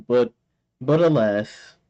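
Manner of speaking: ordinary delivery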